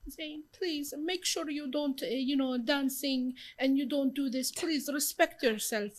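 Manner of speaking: a worried mom begging her daughter; mom pleading. Retold or imitated. Mom warning her daughter before the shoot, slow pleading voice. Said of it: "my mom was like".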